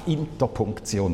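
interpunktion said the German way, soft; German word. Shown as a demonstration. Preacher naming the German term for punctuation, German pronunciation. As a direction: interpunktion with German sounds, ending in -tsion. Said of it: "we say in German".

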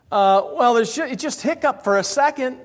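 ordinary delivery

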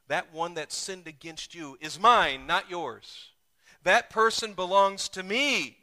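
loud, high kingly claim; the king speaking. Acted. King claims the offender as his, loud. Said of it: "The king says".

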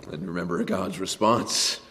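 normal talking voice

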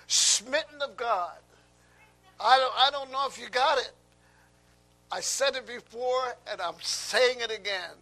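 loud, high preacher's declaration; full-force preaching. Acted.

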